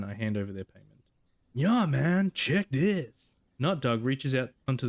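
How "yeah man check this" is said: high, loud bro cheer; pumped jock. Acted. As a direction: show off proudly like a frat guy. Jock shows off sticker.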